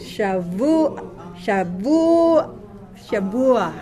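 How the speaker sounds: "Shavu" with a drawn-out u, then complete "Shavua"; sounding out. Shown as a demonstration. Teacher modelling a new word for students, "Shavua" sounded out slowly. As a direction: build up "Shavua", holding the u before the final a.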